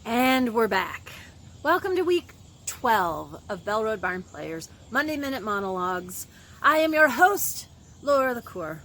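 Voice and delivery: loud, projected show opening; show host announcing. Acted. Host welcoming viewers back to Monday monologues, loud announcer style. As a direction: announce it big, like a host taking the stage.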